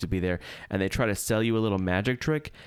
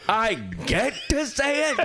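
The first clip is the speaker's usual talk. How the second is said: slow, much higher, hammed-up glee; mock excited; 11 semitones higher than usual. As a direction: gush like a thrilled kid. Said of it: "You said".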